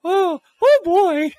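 very high falsetto cartoon mouse; high, loud Mickey Mouse voice; Mickey Mouse impression. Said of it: "All right, Mickey".

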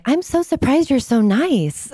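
high, gushing, surprised voice of a stranger; mock surprised follower. Fans messaging her, voiced in high mock amazement. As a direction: squeal it like a startled fan. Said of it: "people will reach out to me".

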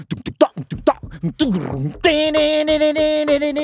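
vocal drum beats then high la-la; beatbox the drum pattern; drum imitation. Shown as a demonstration. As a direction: mouth the song's drums. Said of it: "กลองเขาตี".

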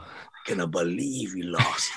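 a father letting his son down gently but heavily; disappointed dad. Retold or imitated. Boxer imitating his dad after a lost fight, low and disappointed. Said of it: "looking at my dad shake his head".

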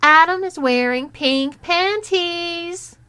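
slow, high, drawn-out taunting yell; loud, high public announcement, stretched out; loud shout. A narrator's reading. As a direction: shout it for everyone around to hear. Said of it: "she shouted loudly".

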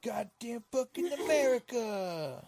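high, loud, drawn-out mock patriotic holler; mock rallying cry, stretched out; hammed-up patriot. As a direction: bellow it slowly like an over-the-top patriot.

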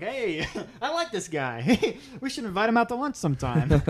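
clueless, cheerful guy, laughing through it; David impression. Mocking the clueless husband while watching the movie, laughing. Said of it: "That's my David impression".

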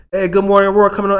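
put-on excited kid. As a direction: rush it out like a child. Kid's real excuse.

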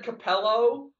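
Capello, ending in O where Capella has A; Clyde's mispronunciation. Retold or imitated. Quoting a broadcaster's slip to co-hosts, O-ended Capello. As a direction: end Capello on a clear O. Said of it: "Instead of Capella, he said".

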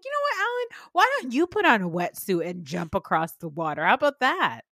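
sarcastic retort. Retold or imitated. Podcaster mocking Alan for laughing at her, sarcastic. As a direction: scoff at him with a sharp sarcastic edge. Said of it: "And I was like".

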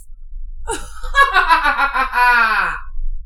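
cackling witch laugh; loud cackle like a witch. Real, not acted. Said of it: "That was my witch laugh".

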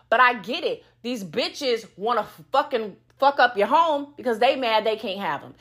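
ordinary talk, nothing marked

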